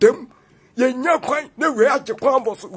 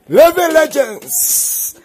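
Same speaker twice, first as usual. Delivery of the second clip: loud, higher and very slowly drawn out; hyped proclamation; 5 semitones higher, 5 dB louder, much slower than usual. Acted. Signing off the video, slow loud hyped proclamation. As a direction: proclaim it like a title.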